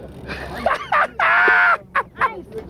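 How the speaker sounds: high shouted laugh of delight; thrilled laugh. Real, not acted. Fish running on the line.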